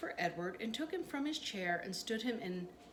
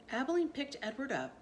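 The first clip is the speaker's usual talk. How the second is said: ordinary delivery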